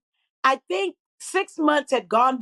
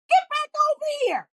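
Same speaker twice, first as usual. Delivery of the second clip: calling someone over; 14 semitones higher than usual. Retold or imitated. Woman ordering a famous architect back for the photo, high insistent call. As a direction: reel him back with a high, stretched-out holler.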